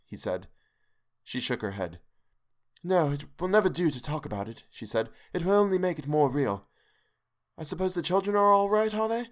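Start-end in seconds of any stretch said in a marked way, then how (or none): 2.8-9.3 put-on high, shaken young wife still trembling; a scared wife calming herself; frightened wife. Hester voiced in story.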